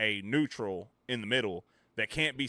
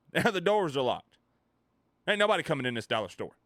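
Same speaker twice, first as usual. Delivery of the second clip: hammed-up spiteful announcement, raised pitch; mock defiance; 5 semitones higher, 4 dB louder than usual. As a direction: play a petty worker refusing everyone. Acted worker locks up in spite.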